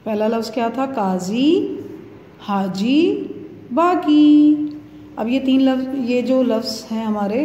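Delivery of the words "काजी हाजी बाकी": काजी and हाजी rising, बाकी held higher and louder; long ee endings. Shown as a demonstration. Teacher recapping first words, काजी, हाजी, बाकी with long ee endings. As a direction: chant काजी, हाजी, बाकी singly, each closing on ee. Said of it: "उसमें छोटी यही आ रही है".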